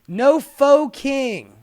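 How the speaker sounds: "No faux king" drawn out slowly, "faux" like foe; slow punny reading; sign pun read out. Shown as a demonstration. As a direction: savour each word of "No faux king". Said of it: "I like that".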